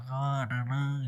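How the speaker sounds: da da da with nose pinched, muffled. Shown as a demonstration. Teacher pinching her nose to test for gunnah. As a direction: pinch the nose and repeat da softly. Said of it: "suara-suara orang jualan boraks di sensor".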